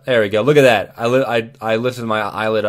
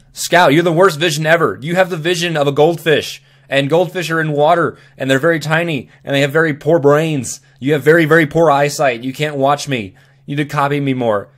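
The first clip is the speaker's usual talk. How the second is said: higher, louder playful telling-off; mock scolding; 5 semitones higher, 5 dB louder than usual. Vlogger scolds Scout for losing track, mock outrage.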